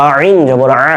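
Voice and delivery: "আইন জবর আ" with squeezed throat vowels; pressed throat sound. Shown as a demonstration. Teacher demonstrating the pressure, squeezed "আইন" and "আ". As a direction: press hard on "আইন" and the final "আ". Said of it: "pressure পড়ছে".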